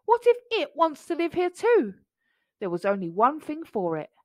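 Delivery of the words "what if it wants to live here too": high, worried question; fretful storybook tiger; trembling. A narrator's reading. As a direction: ask it high in mounting dread. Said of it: "Tiger trembled".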